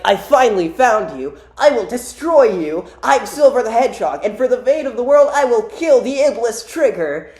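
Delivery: hammed-up vow of revenge; Silver. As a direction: declare your mission with cartoon drama. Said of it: "he's like".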